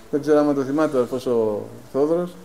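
ordinary delivery

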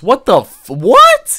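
loud, very high squawk of disbelief; high-pitched, incredulous shriek; shocked outburst. Real, not acted. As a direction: yell it in shock, voice leaping up. Shocked reaction to the story.

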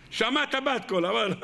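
ordinary talk

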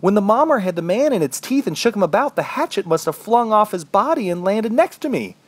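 high-pitched, amazed excitement; elated discovery. A narrator's reading. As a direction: tell it giddy with sudden relief. Overjoyed at finding a hatchet.

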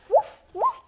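high, loud yappy woof; loud puppy bark. Acted. Puppy barks back at its owner, high loud woof. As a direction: yap it out like an eager little dog.

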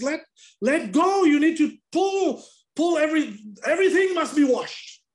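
loud, high urgent commands; urgent pastor shout. Retold or imitated. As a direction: yell it like pulling someone from the water. Pastor pulling man in water.

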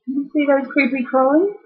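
ordinary delivery, nothing marked

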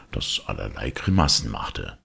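plain reading voice

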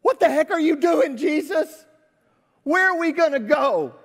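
loud, high-pitched exasperated outburst; Thomas. Acted. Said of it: "Thomas is sitting there saying".

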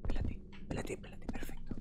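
whispered, tense. Real, not acted. Sneaking through the level, whispering. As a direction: hush it out under the breath.

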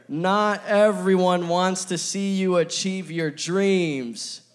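slow, loud group reading of the point; reading together aloud. Shown as a demonstration. Preacher leading the church to read the point slowly aloud. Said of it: "We can read it together".

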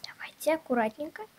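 soft whisper while placing tiny toys; hushed, careful whisper; whispered carefully. Real, not acted. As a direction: breathe it out so nothing falls. Carefully placing trolls in house.

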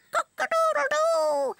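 high loud cock-a-doodle-doo; rooster crow. Acted. Weathercock crows.

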